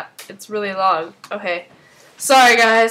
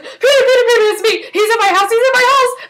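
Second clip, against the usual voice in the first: much higher, louder frantic plea with a nervous laugh; panicked call; 11 semitones higher, 4 dB louder, faster than usual. Retold or imitated. Olivia on the phone to Peter while the killer chases her, panicked. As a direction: beg for help in a breathless panic. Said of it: "And she's like".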